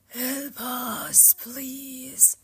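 high, airy ghostly whisper. A narrator's reading. Ghosts clutch at Orpheus, whispering. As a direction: whisper it as a needy plea.

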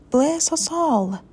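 high-pitched startled cry; acted shock, pitch jumping up; startled exclamation. A narrator's reading. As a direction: exclaim it like a startled storybook mother. Mother startled by jumping pudding.

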